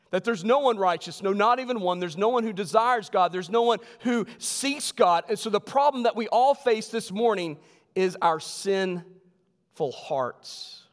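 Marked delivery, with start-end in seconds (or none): 0.1-6.9 emphatic sermon delivery, raised and driving; preaching. Acted. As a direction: hammer each "no" home to the congregation. Preaching at full force.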